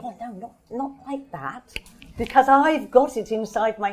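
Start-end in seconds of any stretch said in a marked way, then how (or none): 0.0-1.8 retold inner protest. Author recalling her reaction to the actress, soft inner protest. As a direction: murmur it like a private thought. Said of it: "kept thinking".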